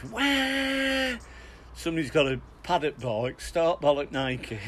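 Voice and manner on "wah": a high wild whoop in far-off voice; high whooping yell. Retold or imitated. Naked rider bursting into the bar, high whoop. All you can hear is.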